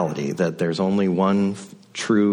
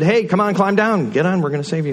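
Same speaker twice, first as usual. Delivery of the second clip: higher, louder call up to the roof; calling out from a boat; 10 semitones higher, 4 dB louder, faster than usual. Retold or imitated. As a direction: hail a man on a roof to climb down. Rowboat men calling to roof.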